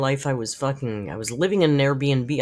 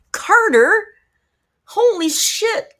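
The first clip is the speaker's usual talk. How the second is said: sudden high shocked cry of the name, then a slow stunned curse; stunned surprise; 7 dB louder, much slower than usual. Real, not acted. Streamer spotting Carter's good news in chat, stunned surprise. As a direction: gasp out the name in disbelief.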